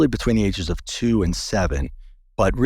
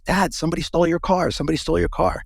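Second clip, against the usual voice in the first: put-on higher, fast, urgent kid voice; alarmed son; 8 semitones higher, faster than usual. Son waking dad, hurrying with alarm about the car. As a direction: rush it out like a worried boy.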